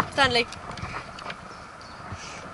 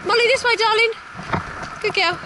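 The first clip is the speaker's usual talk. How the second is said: coaxing call; 10 semitones higher than usual. Real, not acted. Calling the dog over.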